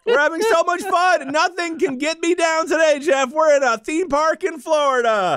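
high, shouting theme-park thrill; hammed-up excitement. Host pretending to visit Orlando with Jeff, hammed-up excitement. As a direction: play the overexcited tourist at full pitch.

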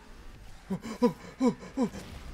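quick unvoiced huffing gasps; repeated gasps. Real, not acted. Gamer reacting to the play, gasping. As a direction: gasp in a string of short puffs.

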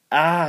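high, loud startled scream; frightened scream. A narrator's reading. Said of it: "she cried".

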